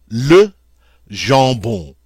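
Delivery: ordinary talk, nothing marked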